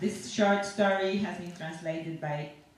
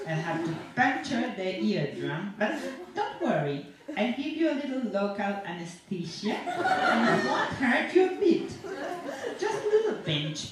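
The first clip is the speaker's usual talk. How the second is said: chuckling; 5 semitones higher, 3 dB louder than usual. Acted. Cheerful talk of puncturing eardrum.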